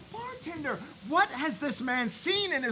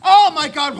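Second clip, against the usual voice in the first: rousing shout; 3 semitones higher, 8 dB louder than usual. Real, not acted. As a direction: proclaim it like a revival preacher. Rousing speech about new body.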